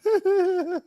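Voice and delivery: high-pitched laugh; amused laugh. Real, not acted. Reactor laughing at critics living in the UK, amused laugh.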